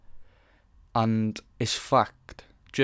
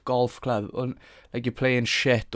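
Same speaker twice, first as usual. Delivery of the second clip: ordinary talk, nothing marked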